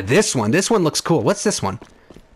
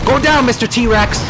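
normal talking voice

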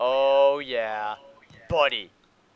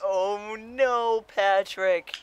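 high whiny dismay; 8 semitones higher, much slower than usual. Real, not acted. Dismay at friend.

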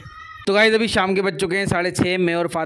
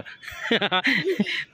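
amused run of laughter; real laugh; 3 semitones higher, 4 dB quieter than usual. Real, not acted. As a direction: chuckle at your own slip. Laughs at own word-order slip.

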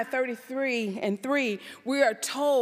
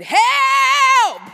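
full-voice shout, much higher and louder; desperate cry for help; 12 semitones higher, 13 dB louder than usual. Acted. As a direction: bellow it like a drowning man. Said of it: "This is what he said".

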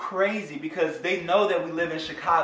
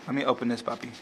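ordinary talk

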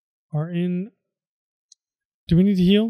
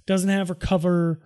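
ordinary talk

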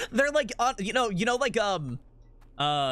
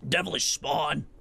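game character imitation; 3 semitones lower, slower than usual. Mimics game character's line just heard.

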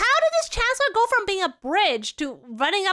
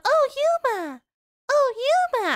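put-on anime girl call; 7 semitones higher, 3 dB louder than usual. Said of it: "I'm like".

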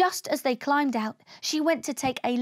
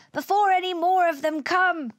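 higher, anxious urging; mildly panicked, raised voice; urgent cry; 6 semitones higher, 3 dB louder than usual. A narrator's reading. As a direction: cry out to hurry a crewmate. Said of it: "cried Og".

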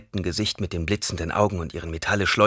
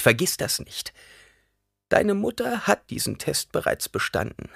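put-on old crone voice; 8 semitones higher, 3 dB louder than usual. A narrator's reading. Old woman explaining the deadly test, high and solemn. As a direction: lay down the rules gravely.

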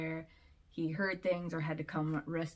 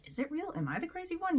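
mock self-doubt; 11 semitones higher, faster than usual. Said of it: "So it's like".

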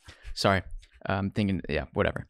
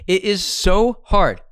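slow, louder, higher hammed-up wail; mock lament; 5 semitones higher, 6 dB louder, much slower than usual. Cohost hamming up despair, loud and slow.

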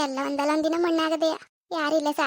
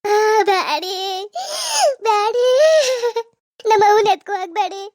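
louder, sobbing cries of no, don't take her; wailing plea; 5 semitones higher, 5 dB louder than usual. Acted. Daughter begging the men not to carry her mother away, louder wailing sobs. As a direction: cry out and beg through sobs, louder. Daughter wails as they lift the body.

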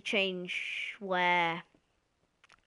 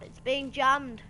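raised, drawn-out dismay; loud complaint; 5 semitones higher, 7 dB louder, slower than usual. Real, not acted. Gamer finding the mini-map jammed, loud dismayed cry. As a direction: cry it out slowly, louder and higher.

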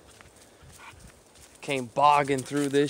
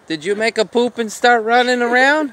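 high, loud, excited pet-talk voice; 10 semitones higher, 7 dB louder than usual. Pretend. Owner asking pet about running around, high playful pet talk. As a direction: tease the animal with squealed-up questions.

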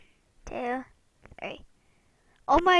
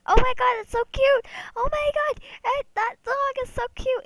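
excited squeal; 9 semitones higher, 6 dB louder than usual. Real, not acted. Opening a gift excitedly.

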